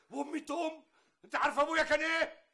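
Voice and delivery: offended guest shouting over the insult to his father; furious shout. Acted. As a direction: bellow at her in offended rage.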